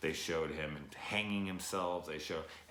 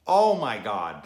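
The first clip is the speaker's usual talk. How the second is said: real overwhelmed outcry, louder; loud exclamation; 4 semitones higher, 13 dB louder, slower than usual. Real, not acted. Vlogger overwhelmed recalling the daughter scene, loud outcry. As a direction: blurts it out, hit by the scene.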